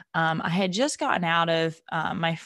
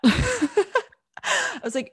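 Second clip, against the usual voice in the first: laughing; 12 semitones higher, 4 dB louder, much slower than usual. Real, not acted. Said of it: "laugh while retelling".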